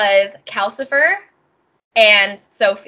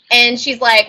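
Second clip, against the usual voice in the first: ordinary talk, nothing marked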